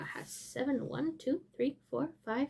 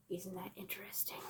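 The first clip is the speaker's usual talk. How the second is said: whisper; 5 semitones lower, 17 dB quieter than usual. Real, not acted. Aside to viewers.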